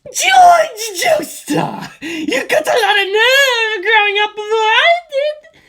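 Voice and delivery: high, slow screaming zombie voice; villain shout. Acted. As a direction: shriek it as the flying zombie. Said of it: "he shouted as he flew".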